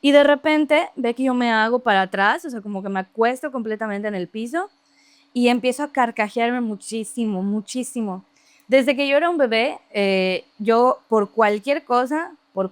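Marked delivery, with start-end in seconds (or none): none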